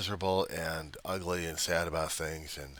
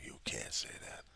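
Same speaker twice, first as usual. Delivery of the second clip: jeering whisper; 7 dB quieter than usual. Retold or imitated. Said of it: "leering, jeering face of the voice saying".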